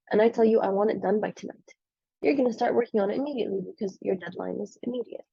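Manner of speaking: ordinary talk, nothing marked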